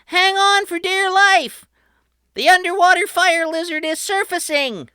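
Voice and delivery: very high, loud urgent cry; alarmed shout. A narrator's reading. Patrick warning his crew of the monster, high and urgent. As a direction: raise the alarm. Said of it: "Patrick shouted".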